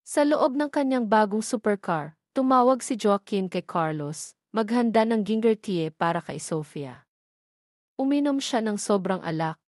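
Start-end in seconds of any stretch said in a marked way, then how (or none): none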